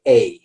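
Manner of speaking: stressed article "a" pronounced like the letter A; full-vowel "a". Shown as a demonstration. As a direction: say "a" as a clear "ay". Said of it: "very rarely, you would say".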